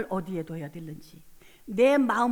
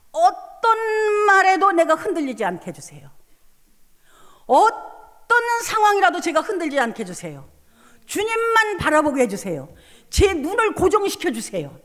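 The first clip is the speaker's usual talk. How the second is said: louder, choked-up pleading to God; tearful prayer; 8 semitones higher, 7 dB louder than usual. Retold or imitated. A pastor reliving her desperate prayer, tearful and loud. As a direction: cry out the prayer through tears. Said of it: "그 기도를 막 했어요".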